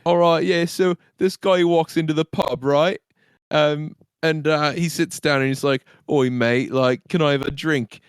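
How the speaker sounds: chatty put-on British accent; joke-telling stable boy. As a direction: play a matey British pub joker. Player voices British stable boy.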